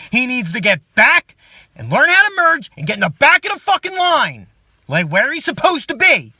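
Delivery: loud, high, furious rant at the cutter-in. Acted. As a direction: yell it, outraged at the line-jumper. Furious at driver cutting in.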